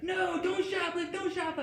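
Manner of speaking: alarmed high falsetto plea. Acted. Imagined pop star begging him not to steal, squealed falsetto. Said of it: "And she was like".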